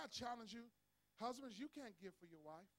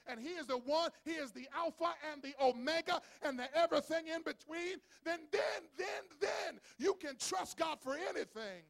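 louder, higher, shouting preacher; full-force preaching; 5 semitones higher, 10 dB louder than usual. Acted. Preacher rousing the congregation, shouting sermon. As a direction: build to a roaring climax.

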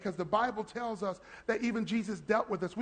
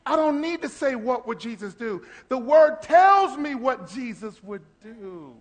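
preaching emphasis; 3 semitones higher, 8 dB louder than usual. Real, not acted.

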